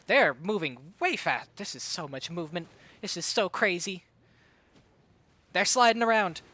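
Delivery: rapid, rising esports commentary; live play-by-play. Real, not acted. Caster calling the match for stream viewers, hyped play-by-play. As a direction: call every move as it happens.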